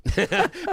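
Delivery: high, loud burst of laughter; helpless laugh. Real, not acted. Recalling losing it in the pageant, real laughter. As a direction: lose it laughing. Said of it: "I lost it".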